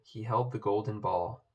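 usual speaking voice

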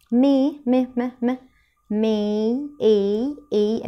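made split into مي and م, with a long A; syllable by syllable. Shown as a demonstration. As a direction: sound out made and hold the A. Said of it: "أدي ال A منطوقة أهي". (تقطيع made مع مد حرف A)

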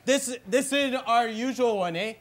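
exaggerated Canadian hoser drawl, eh; Bob McKenzie hoser voice. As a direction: play the dopey Canadian brother hosting his bit. In character.

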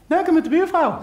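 put-on very high, loud squeaky kid voice; child's answer. Toddler answering his mother about dad, squeaky and loud. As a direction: chirp it out like a little kid.

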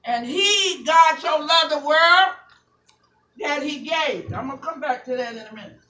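loud, chanted, half-sung sermon climax, drawn out slow; sing-preach the close, stretching the words; whooping preacher. Acted. Pastor whooping to church over organ, loud and sung.

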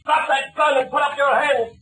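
slow stern command to surrender; ordering the captured man to drop his gun; commanding shout. Acted.

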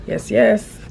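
ordinary talk

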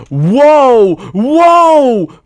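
high, very loud drawn-out whoa; real burst of alarm; startled shout. Real, not acted. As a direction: yell out in shock at the game. Startled by the game.

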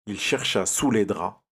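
plain reading voice

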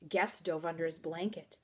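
usual speaking voice

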